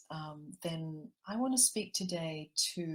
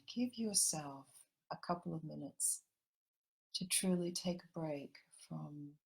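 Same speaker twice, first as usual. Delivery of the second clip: gentle meditation guide drawing everyone into the heart; guided meditation; 4 dB quieter than usual. Acted. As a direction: ease the listeners into calm, unhurried.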